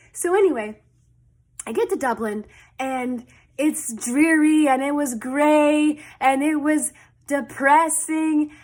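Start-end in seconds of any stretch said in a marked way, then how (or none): 2.8-8.5 slow, louder, stretched out; dramatic drawl. Real, not acted. Describing gloomy Dublin to viewers, slow dramatic emphasis. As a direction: lay it on thick, word by word.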